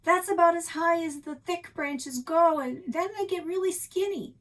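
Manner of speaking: high, loud call down from a tree; shouted from high up. A narrator's reading. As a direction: call it down from the treetop.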